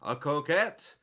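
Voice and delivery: lightly voiced announcer reading. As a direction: read it like a showy booklet blurb. Reads booklet in announcer style.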